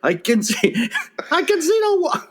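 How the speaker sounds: put-on high, laughing Japanese accent; a hint of the bar girl, laughing; mock Japanese accent. Mimicking the Japanese bar girl, laughing mock accent. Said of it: "she goes".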